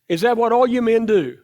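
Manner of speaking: usual speaking voice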